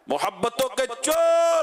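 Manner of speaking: slow, high call stretching چور out long; slogan call. Shown as a demonstration. Preacher calls the congregation to chant together, slow and loud. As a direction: lead the crowd's chant. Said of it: "اسے سب مل کر نعرہ لگائیں".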